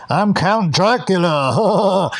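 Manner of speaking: high, drawn-out hammy Dracula call; Alex Jones heckling. Retold or imitated. Heckler at the finals match, hammed-up Dracula voice. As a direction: ham it up as a heckling Count Dracula. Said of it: "Alex Jones goes".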